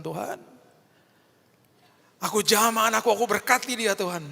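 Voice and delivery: high, loud impassioned prayer; fervent prayer. Retold or imitated. Father praying aloud over his crying daughter, high and fervent. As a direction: pray over your child with full force.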